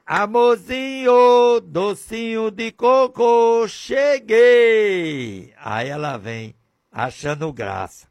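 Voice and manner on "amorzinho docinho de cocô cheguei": higher, louder, slow syrupy coo; sugary husband voice. Acted. Preacher playing a husband cooing to his wife at the gate. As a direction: put on a syrupy sweetheart voice. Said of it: "Eu chego em casa, eu".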